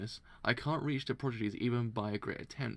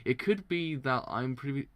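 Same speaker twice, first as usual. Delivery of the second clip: normal talking voice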